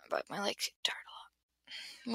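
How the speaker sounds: soft unvoiced whisper, a private correction; whispered aside. Real, not acted. Correcting herself to the viewers in a whisper. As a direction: whisper it as if to yourself.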